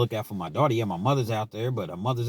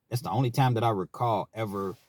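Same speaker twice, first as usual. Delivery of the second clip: normal talking voice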